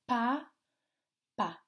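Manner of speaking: PA, then PA after a pause; separate syllables. Shown as a demonstration. Teacher shows both syllables, PA and PA apart. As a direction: say each PA alone. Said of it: "dans les deux syllabes".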